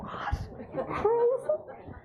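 high, loud, drawn-out disbelief with laughter; acted-out shock, voice leaping up; incredulous outburst. Author replays reaction to agent turning down offer, incredulous.

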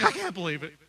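a touch of offended disbelief; half-hearted huff at being criticized; mild mock indignation. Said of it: "I'm like".

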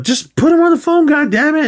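high, loud exasperated outburst; mock furious phone demand; retold angry yell. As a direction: blow up at whoever's on the line. Frustrated man yelling on the phone.

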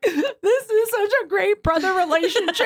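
high, laughing gush; tearful laughing gush; laughing through tears. Retold or imitated. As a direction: gush while half crying. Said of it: "I'm over here like laughing crying".

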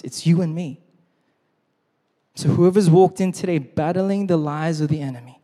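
normal talking voice

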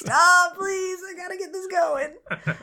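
high-pitched begging through laughter; laughing plea. Real, not acted. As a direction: plead helplessly while cracking up. Begging co-host to stop singing.

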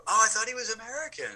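high-pitched puzzled onlooker; lifted, put-on voice of other people; imitating confused people. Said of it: "they kinda go".

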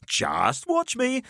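slow, high, cocky dare; defiant Jamie. A narrator's reading. Jamie daring the narrator as he opens the box, slow cocky dare. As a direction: taunt him, slowly.

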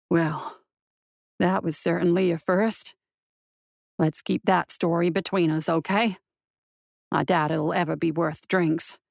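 low, dry marshal voice; wry marshal. A narrator's reading. Marshal confides in his horse after waking, wry.

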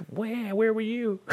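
high, slow, hurt little voice; needy plant voice. Pretend. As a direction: whine it like a neglected plant.

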